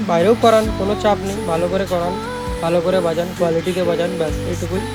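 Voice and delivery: ordinary delivery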